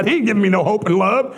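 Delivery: usual speaking voice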